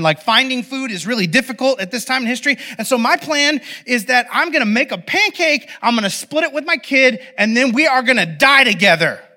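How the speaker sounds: high-pitched exasperated rant; snapping, yelling. Acted. Widow at the end of her rope yelling at prophet. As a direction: lose it in a worked-up tirade. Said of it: "she starts yelling at him".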